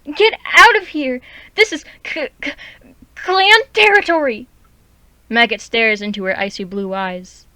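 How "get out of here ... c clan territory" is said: high, loud, stammering threat; she-cat yelling at a rogue to leave clan territory; angry hissing yell. Acted. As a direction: drive the intruder off, furious and scared.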